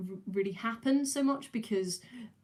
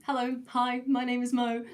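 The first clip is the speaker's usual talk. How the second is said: stand-up opener; 5 semitones higher, 5 dB louder than usual. Retold or imitated. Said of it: "that was the introduction to every single one of my comedy sets".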